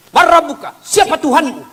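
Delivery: high, loud interrogating angel; stern angel voice. Acted. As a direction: question the dead like a fearsome angel. (suara malaikat yang menginterogasi dengan keras)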